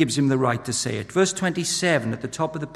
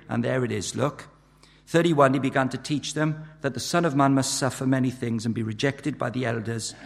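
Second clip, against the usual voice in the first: ordinary talk, nothing marked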